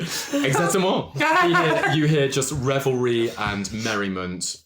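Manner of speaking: giggling through the description; laughing. Real, not acted. GM laughs at reference.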